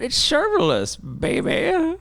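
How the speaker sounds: high, drawn-out developer voice; mock a clueless developer. Mocking a developer's excuse.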